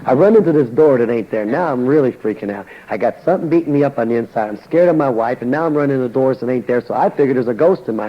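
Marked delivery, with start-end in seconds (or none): none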